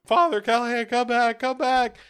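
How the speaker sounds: high loud call after him. Retold or imitated. Wife chasing the priest, a high shouted plea.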